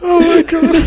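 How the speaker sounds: squeaky laughing exclamation; gasping through laughter. Real, not acted. Host cracking up on air.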